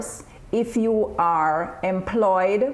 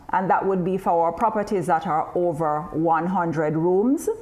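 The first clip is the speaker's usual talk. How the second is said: ordinary talk, nothing marked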